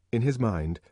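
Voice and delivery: usual speaking voice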